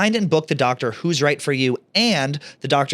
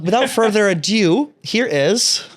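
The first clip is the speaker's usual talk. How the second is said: high, loud, slow showbiz intro; mock-grand announcer build-up; grand host announcement; 7 semitones higher, 4 dB louder, slower than usual. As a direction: announce the guest like a game-show host.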